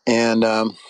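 usual speaking voice

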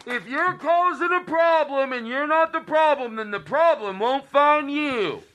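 high, slow homespun advice voice; drawn-out mock Doctor Phil. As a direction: deliver folksy nonsense wisdom like the TV doctor. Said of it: "his impersonation of James Adomian's impersonation of Doctor Phil".

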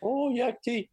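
very high, laughing mimic of His Holiness; very high, delighted imitation. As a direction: squeal the words out through amusement. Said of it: "And he goes".

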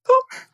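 a sharp, high "pop" like a burst balloon; popping sound. Retold or imitated. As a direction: mimic a needle popping a bubble. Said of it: "got this pin and went".

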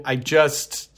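normal talking voice

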